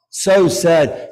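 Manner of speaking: loud, anguished lament; grieving cry. Real, not acted. Preacher mourning Ali al-Akbar to the congregation, grieving loudly. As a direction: let the grief burst out.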